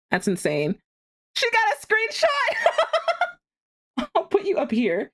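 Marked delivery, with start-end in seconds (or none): 1.3-4.1 very high, slow, laughing shriek of surprise. Real, not acted. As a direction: squeal it out in delighted shock.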